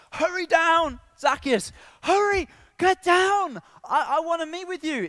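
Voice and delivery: very high, loud, pleading summons; urgent calling. Acted. Jesus calling Zacchaeus down from above, urgent. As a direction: call him down as if it cannot wait. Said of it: "There's this urgency".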